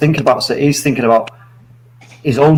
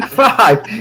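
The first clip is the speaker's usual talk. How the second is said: high laughing "Five?"; laughing disbelief; 7 semitones higher than usual. Real, not acted. Hears friend takes five sugars.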